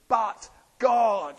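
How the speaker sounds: "But God" called out high and loud; exultant cry of "But God"; emphatic proclamation. Acted. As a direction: ring out "But God" like a bell. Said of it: "verse four just starts with".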